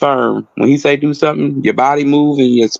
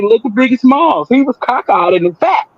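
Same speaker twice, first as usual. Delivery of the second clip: much higher, lively teasing; playful hype; 9 semitones higher than usual. Real, not acted. Barber cheering up the heartbroken client, high playful teasing. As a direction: pump him up with a joke.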